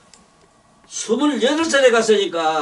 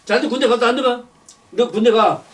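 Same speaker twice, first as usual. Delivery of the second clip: normal talking voice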